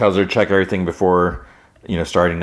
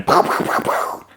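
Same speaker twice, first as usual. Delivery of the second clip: imitated gunshots; 10 semitones higher than usual. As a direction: mouth gunshot pops, fading to a breath.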